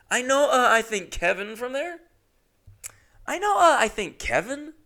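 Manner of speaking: hammed-up dopey mimicry; mocking reread. Mocking the text repeatedly.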